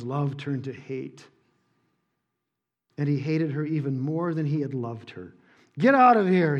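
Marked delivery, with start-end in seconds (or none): 5.8-6.6 loud, higher snarl. Acted. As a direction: spit it out at her. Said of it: "he snarled at her".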